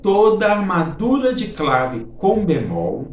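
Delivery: slow, deliberate restatement of a rule; emphatic repetition. Shown as a demonstration. Teacher repeating the key rule on request, slow and emphatic. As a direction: repeat it slowly, stressing each part. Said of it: "Repete, professor".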